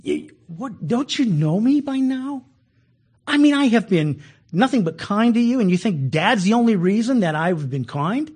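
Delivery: high, pleading voice, hurt by his brothers' doubt; hurt, pleading Joseph. Retold or imitated. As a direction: ask it like a wounded brother who can't believe it. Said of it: "He said".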